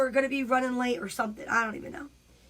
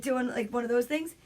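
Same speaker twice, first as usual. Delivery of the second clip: ordinary talk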